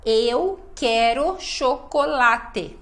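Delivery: slow, syllable-by-syllable "Eu quero chocolate"; every syllable pronounced. Shown as a demonstration. Teacher showing the syllabic rhythm of Portuguese, every syllable pronounced. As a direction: give each syllable of "Eu quero chocolate" its full beat. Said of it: "a gente fala cada sílaba".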